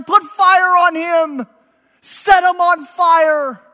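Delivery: high, slow mock crowd yell. Hateful crowd to the executioners, a high slow yell. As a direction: call for the burning. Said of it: "they started yelling".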